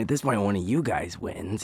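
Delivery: ordinary talk, nothing marked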